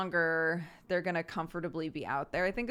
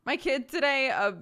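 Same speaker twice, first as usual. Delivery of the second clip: ordinary talk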